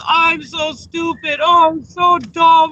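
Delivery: high, slow mock wail; drawn-out wailing impression of a friend; distraught self-blame. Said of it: "she was".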